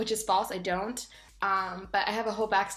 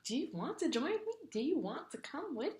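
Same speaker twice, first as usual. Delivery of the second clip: soft, shy, halting whisper; 8 semitones higher, 4 dB quieter than usual. Retold or imitated. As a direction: ask in a timid hush. Shyly inviting the man along.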